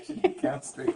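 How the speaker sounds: high laugh through the words; laughing mockery. Real, not acted. Husband mocking his wife's phrase, laughing. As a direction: giggle out the phrase.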